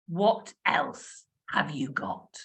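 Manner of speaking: low, slow mock-demanding ask. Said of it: "in a very demanding voice".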